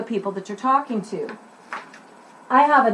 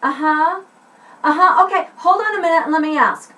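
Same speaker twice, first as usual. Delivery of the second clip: phone order; 5 semitones higher, 4 dB louder than usual. Shown as a demonstration. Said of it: "So I'm gonna start here".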